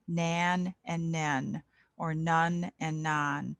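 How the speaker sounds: nan, nen, nun, non each vowel drawn out slowly; minimal pairs, slow. Shown as a demonstration. Tutor drilling minimal pairs for volunteers, slow. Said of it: "what I was just repeating".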